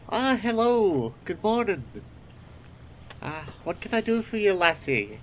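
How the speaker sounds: a jolly baker welcoming a customer; friendly village baker, bright and quick; cheery baker. Acted. GM voices the baker.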